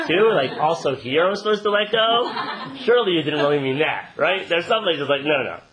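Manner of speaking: loud, high, incredulous and giggling; mock-bargaining with God through laughter; laughing disbelief. Real, not acted. Still laughing at the joke.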